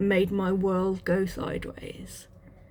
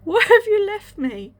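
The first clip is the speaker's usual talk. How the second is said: anguished plea; 10 semitones higher, 5 dB louder than usual. Retold or imitated. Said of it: "I was like".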